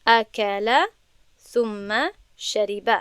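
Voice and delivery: اکل ثم شریب read slowly and deliberately; slow Arabic example. Shown as a demonstration. Teacher giving students an Arabic example slowly. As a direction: read the example clearly, word by word. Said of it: "مثال".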